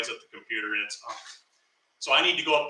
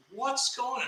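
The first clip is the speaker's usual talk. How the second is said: high, soft retold question; hushed questioning; 9 semitones higher, 6 dB quieter, slower than usual. Storyteller replays asking coworker what's happening, hushed and high. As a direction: ask it small and high, under your voice.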